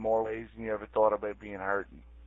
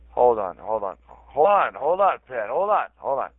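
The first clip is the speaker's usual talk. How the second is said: agitated interrupting; 3 semitones higher, 7 dB louder than usual. Real, not acted. Cutting off others in quarrel.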